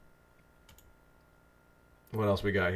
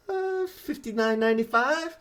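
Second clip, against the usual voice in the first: much higher, slow, unsure voice of a salesman; timid salesman. Retold or imitated. Salesman with no self-belief meekly naming his price, high and slow. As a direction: squeak the price out like a nervous salesman. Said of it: "And so they're kinda like".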